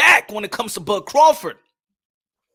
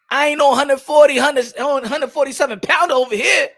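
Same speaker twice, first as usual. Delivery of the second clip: normal talking voice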